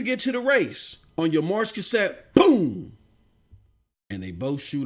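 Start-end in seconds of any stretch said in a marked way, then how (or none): 2.3-3.0 sudden loud boom like a starting pistol; punchy mock gunshot; starting gun boom. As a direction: bang it out like the gun going off. Starting gun in race story.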